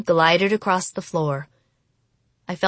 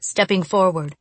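usual speaking voice

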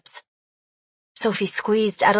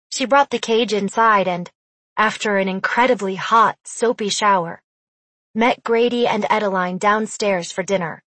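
usual speaking voice

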